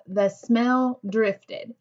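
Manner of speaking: each word drawn out slowly; slow, careful read-aloud voice. Shown as a demonstration. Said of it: "And I have".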